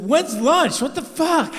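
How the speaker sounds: high, loud exasperated outburst; exasperated yell. Retold or imitated. To the fishing guide, a high loud fed-up yell. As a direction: shout it out, fed up and laughing.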